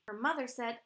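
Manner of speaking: usual speaking voice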